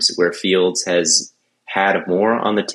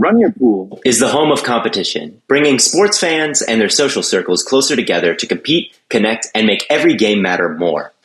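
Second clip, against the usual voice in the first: lively, scripted sponsor read; polished host-read commercial; 4 semitones higher than usual. Real, not acted. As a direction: read it as a podcast promo with lifted emphasis. Reads a sponsor ad.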